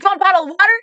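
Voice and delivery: imitated gushing, high and loud; excited supporter. Supporter greeting the candidate from his truck, excited.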